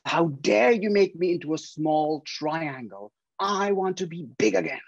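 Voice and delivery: hammed-up huff of an offended paper; angry paper. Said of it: "got even louder and angrier".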